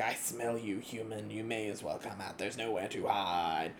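soft, slow menacing villain; slow theatrical villain menace. Acted. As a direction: purr the threat like a hidden villain. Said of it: "begins his villain monologue".

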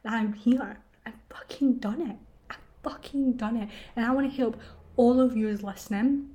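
usual speaking voice

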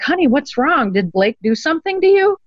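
retold motherly concern, pitch lifted. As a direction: imitate a mother anxiously checking on her child. Worried mother questioning her frightened child.

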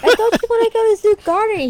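high, quick mock-mom protest; put-on incredulous mother; imitated mom. Voicing mom questioning son.

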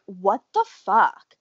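usual speaking voice

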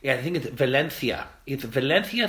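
Valencia with a lisped c, Va-len-thia; Spanish lisp. Shown as a demonstration. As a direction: relish saying Valencia the Castilian way. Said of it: "I like saying it".

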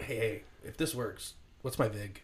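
low, hushed deal-making murmur; playful hostage quietly angling for a cut; conspiratorial aside. Pretend. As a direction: sidle up and ask under your breath. Hostage sidles up to robber.

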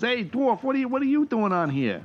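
high-pitched New York teamster; poor New York accent. Acted. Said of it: "really poor New York accents".

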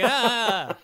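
startled laughing yelp, loud and high. Retold or imitated. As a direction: yelp as if the spider just touched you. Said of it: "I was like".

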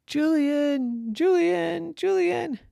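high, loud, drawn-out name calls; searching parent's holler; calling out. Acted. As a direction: call for a lost child. Parents calling for lost son.

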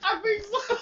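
high, laughing reply; cracking up. Real, not acted. Laughing hard.

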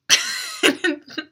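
high burst of laughter. Real, not acted. Laughs at Kendall's refusal.

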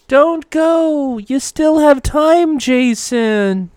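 high, drawn-out pleading call; ghostly plea. Acted. Dead Donna Troy urging Jason to stay, ghostly plea. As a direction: call out slowly, high and loud like an apparition.

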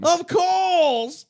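high, drawn-out British reply; British accent. Pretend. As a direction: answer like a prim British butler. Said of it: "Oh, I'm going British".

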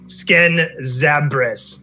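each part of "Skin Zabras" drawn out very long; slow call-out. Acted. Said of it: "There shouts out".

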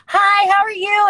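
high, bright polite greeting, loud; sweet greeting voice. Retold or imitated. Imitating her own polite greeting voice to a fan. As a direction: chirp it like a friendly hello. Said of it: "four different voices".

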